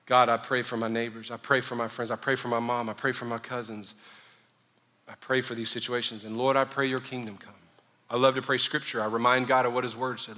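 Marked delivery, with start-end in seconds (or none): 0.1-7.5 softer, lower, intimate praying; quiet prayer. Shown as a demonstration. Preacher modelling intercession for the listeners, softly. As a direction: pray it low and earnest, close to God.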